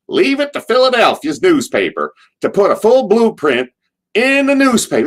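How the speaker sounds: high, loud Southern whine mocking Philly; Cornette impression. Cornette backstage mocking the Philly paper, high Southern whine. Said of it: "as soon as I do his accent".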